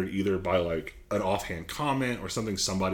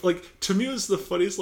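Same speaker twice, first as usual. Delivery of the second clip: usual speaking voice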